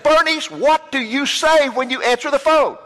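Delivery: high, slowed-down mock exasperation; exasperated demand. Preacher confronting a friend about her phone greeting, exasperated.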